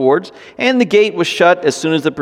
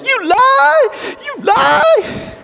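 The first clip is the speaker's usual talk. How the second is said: put-on be a wounded five-year-old shouting back; a five-year-old boy yelling at someone he disagrees with; hurt angry little boy; much slower than usual. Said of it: "all I could hear in my head was".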